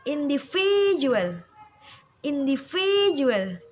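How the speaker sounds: "Individual" with VI loud and drawn out; slow "Individual", leaning hard on VI; stress on V syllable. Shown as a demonstration. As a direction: punch and hold the VI in "Individual". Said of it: "yang ditekan di huruf".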